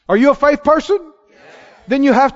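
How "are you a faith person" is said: ordinary talk, nothing marked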